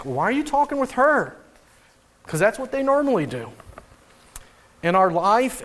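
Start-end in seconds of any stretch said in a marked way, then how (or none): 0.0-1.4 higher mock-indignant question; incredulous disciple. Disciples questioning Jesus about the woman, mock indignant. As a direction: act out the disciples' objection. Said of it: "The disciples don't ask".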